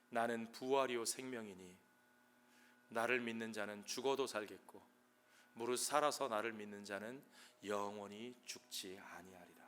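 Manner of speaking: low, soft, measured words of Jesus; solemn quiet quote. Acted. Jesus answering grieving Martha, low and softly solemn. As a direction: drop down into hushed, solemn assurance.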